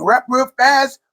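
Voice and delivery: ordinary delivery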